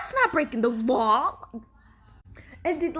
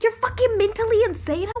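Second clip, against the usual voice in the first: high-pitched indignant outburst; exasperated accusation; 7 semitones higher, 3 dB louder than usual. Acted. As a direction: call him out in disbelief, pitched high. Her acted reaction to the character.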